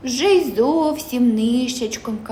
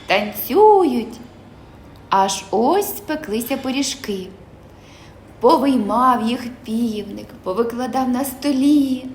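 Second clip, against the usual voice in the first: ordinary delivery, nothing marked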